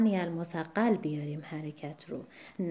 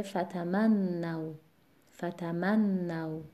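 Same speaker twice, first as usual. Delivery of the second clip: فتمنوا slow, stress on the syllable before the doubled نّ; stress on the mim; much slower than usual. Shown as a demonstration. Quran teacher slowly demonstrates word stress in فتمنوا. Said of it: "وزن کلمه بر قبل از اون مواضع سگانه میاد".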